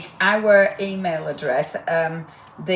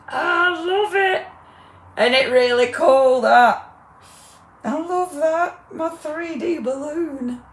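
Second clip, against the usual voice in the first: high, loud, excited squeal; delighted; 8 semitones higher, 6 dB louder, slower than usual. Real, not acted. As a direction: gush over it with glee. Delighted by balloon.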